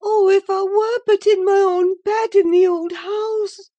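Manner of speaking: high, loud anguished wail; bitter lament. A narrator's reading. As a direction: wail with bitter longing, pitch way up. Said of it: "she went on bitterly".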